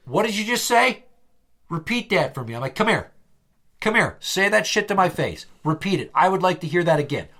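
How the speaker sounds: angry challenge. Retold or imitated. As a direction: call him back, daring him to repeat it. Acted confrontation, challenging a departing coworker.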